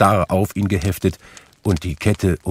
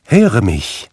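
louder, higher warning; admonishing; 4 semitones higher, 6 dB louder than usual. A narrator's reading. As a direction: urge the listener to pay heed. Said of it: "mahnte der Geist".

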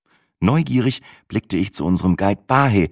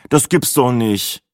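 groaning; 5 semitones higher, slower than usual. A narrator's reading. Narrator groaning at the old man joining, dismayed. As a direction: moan it, fed up. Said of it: "stöhnte ich".

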